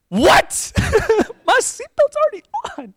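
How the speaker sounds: very high squeal of disbelief; comic outraged shock; shocked protest. Retold or imitated. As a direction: protest in a squeaky, laughing mock shriek. Acts out shock at failing.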